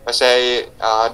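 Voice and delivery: ordinary delivery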